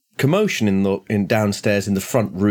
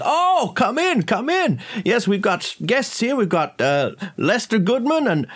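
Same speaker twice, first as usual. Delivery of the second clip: Augustus; 12 semitones higher than usual. Acted. Host welcomes guests at the front door, high and effusive. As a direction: usher guests in with a high, gushing call. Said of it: "Augustus says".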